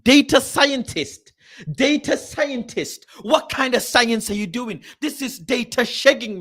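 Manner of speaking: high, incredulous scoffing; scornful outrage. Real, not acted. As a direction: draw out the job title in high disbelief, then challenge it. Mocking a staff job title.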